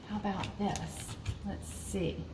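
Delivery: soft muttering to herself while searching; quiet aside. Real, not acted. Searching the craft pile, mutters softly to herself.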